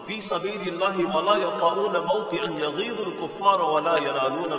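loud, measured Arabic recitation; Quran recitation. Acted. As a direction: recite the verse with projection.

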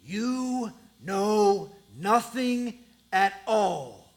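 slow, gravelly villain growl. Acted. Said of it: "he's got this gravelly voice".

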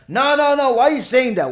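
loud, high acted objection; mock-offended interruption; indignant protest. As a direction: protest like someone just accused. Acts out accused person objecting.

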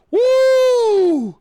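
high loud celebratory whoop. Real, not acted. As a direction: let out a big woo.

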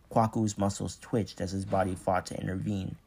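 plain reading voice

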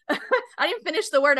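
ordinary talk

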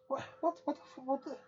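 high, trailing disbelief; stunned. Real, not acted. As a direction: sputter it out in shock.

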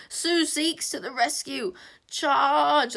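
high, slow battle shriek. A narrator's reading. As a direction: shriek a charge. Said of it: "continuing to shriek".

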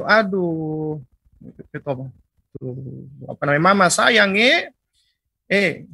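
lightly imitated Maluku eastern Indonesian accent; a hint of a Maluku parent scolding, halting; Maluku-style speech. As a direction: Maluku accent, loose and half-remembered. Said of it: "dia ngomong dengan intonasi yang keras gitu".

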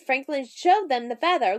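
plain reading voice

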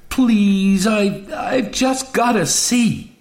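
high, drawn-out, impassioned plea; urgent begging. A narrator's reading. Betty begs the Doctor to take her, urgently. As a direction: beg high, stretching it out.

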